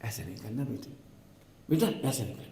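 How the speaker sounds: soft, tender mother-in-law; quiet, affectionate warning; lovingly. Retold or imitated. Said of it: "इतने प्यार से ही बोलती".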